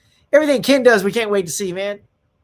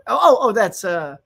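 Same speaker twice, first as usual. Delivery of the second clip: ordinary talk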